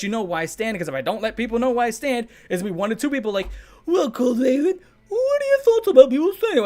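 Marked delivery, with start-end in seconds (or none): 3.9-6.6 much higher, louder nasal-free mocking whine; mock whiny commenter. Mocking a pestering commenter in a much higher, louder whine. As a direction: squeal it like a pestering viewer.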